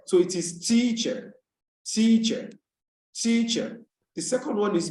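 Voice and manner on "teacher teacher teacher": TEACHER with the first syllable high and drawn out; stress on first syllable. Shown as a demonstration. Teacher models word stress for class, first syllable stressed. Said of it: "the syllable that is stressed".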